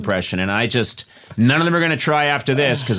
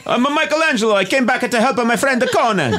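high, laughing, hammy fake Italian; bad Michelangelo impression; 11 semitones higher than usual. Michelangelo defends himself at Chick-fil-A in a hammy Italian accent. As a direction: play Michelangelo with a silly high Italian voice, chuckling. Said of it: "the worst Michelangelo impression".

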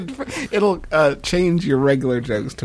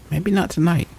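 higher-pitched mock wishful thinking; hopeful stage Caesar; 4 semitones higher than usual. Actor playing Caesar before the stabbing, mock hopeful. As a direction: play an actor talking himself into hope. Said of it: "If I was playing Julius Caesar".

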